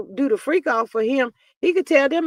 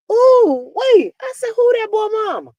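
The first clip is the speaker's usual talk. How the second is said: much higher, louder ooh-wait exclamation; sudden shocked realisation; 12 semitones higher, 8 dB louder than usual. Real, not acted. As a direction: squeal it as if it just hit you.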